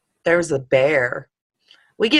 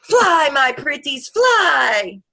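high, hammed-up witch cackle voice; Wicked Witch; 8 semitones higher, 4 dB louder, much slower than usual. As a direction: play the witch, slow and grand. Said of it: "the Wicked Witch theme song".

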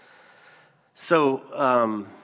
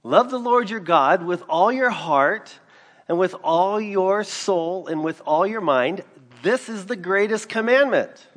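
fervent preaching; 8 semitones higher, 7 dB louder than usual. Acted. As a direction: preach it out to the congregation. Preaching scripture at full force.

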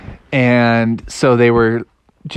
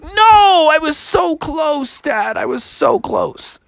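disappointed Froggy; 12 semitones higher, slower than usual. Acted. Froggy to dad after the line broke, slow disappointed whine. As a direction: moan about the lost fish. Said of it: "And Froggy was like".

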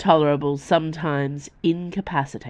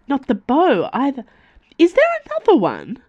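high, louder and fast, still alarmed; startled; 10 semitones higher, 5 dB louder than usual. Acted. Nimue to Dio after the body lands, startled and quick. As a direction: tumble the words out in shock.

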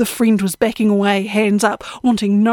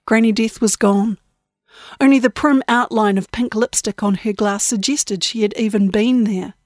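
ordinary delivery, nothing marked

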